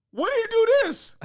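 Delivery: high, loud disbelieving outburst; imitating a coach in loud, high-pitched disbelief; incredulous coach. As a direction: demand it, baffled and exasperated. Coach reacting to false sack claim.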